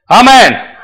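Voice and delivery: high, loud stop command; shout. Acted. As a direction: shout it out to halt the donkey. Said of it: "So he shouts".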